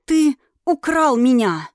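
loud, angry accusation, slowed; hissed accusation. Acted. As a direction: fling the accusation at him. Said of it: "и прошипела".

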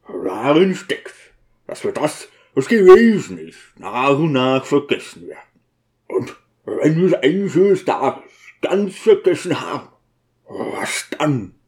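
gruff, halting character voice with sudden swells; anguished deacon working towards his question; grieving deacon, brooding. Acted. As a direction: brood aloud over a painful thought.

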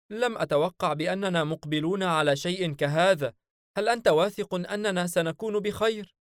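high, anxious companion voice pleading with his master; a nervous spirit begging for reassurance, voiced high; worried jinn sidekick.

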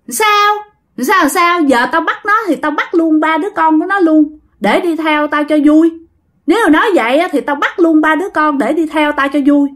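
mocking ghost. Ghost in grandma taunts the exorcist, high mocking. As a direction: sneer high like a gleeful ghost. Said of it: "nó cười lên".

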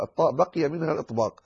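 ordinary delivery

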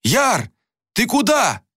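high-pitched alarmed call; urgent high cry, moderate volume. A narrator's reading. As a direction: call after someone, pitch leaping up. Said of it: "крикнул Анатан".

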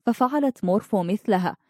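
usual speaking voice

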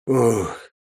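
short stifled groan; involuntary moan of pain. A narrator's reading. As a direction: groan through chest pain. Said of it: "не смог сдержать стона".

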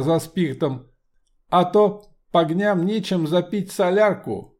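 loud commanding metallic voice; steely voice. Acted. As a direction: shout down the crowd like an iron automaton. Homunculus taunts Ilyich.

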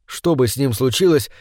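usual speaking voice

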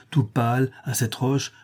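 plain reading voice

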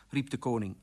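usual speaking voice

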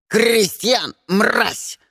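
high, loud croaking bird cry; angry raven voice. Acted. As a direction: screech it like an enraged raven. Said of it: "вырвался из фургона ... Ворон".

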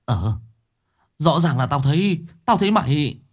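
puzzled, rising insistence; a touch of baffled disbelief; bewildered. A narrator's reading. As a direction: insist in confusion. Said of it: "Sáu Bưởi ngơ ngác".

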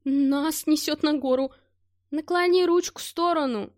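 alarmed shout. Acted. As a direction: yell a warning in alarm. Helicopter heading into mountain. (тревожный крик)

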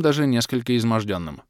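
plain reading voice

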